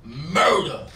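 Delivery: loud, drawn-out announcer intro; hype introduction. Real, not acted. Host presenting Harlem's own battle rapper, hyped introduction. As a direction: draw the name out big and loud. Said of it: "You gotta say it like that".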